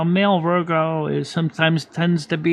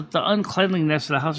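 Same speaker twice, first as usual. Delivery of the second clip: normal talking voice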